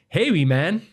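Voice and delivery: high, loud put-on Canadian-Scottish twang; Canadian-Scottish hybrid. Uncle greeting his young nephew, loud Canadian-Scottish twang. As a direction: call out in a mock Canadian-Scottish accent. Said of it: "Like that".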